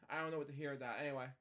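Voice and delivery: ordinary delivery, nothing marked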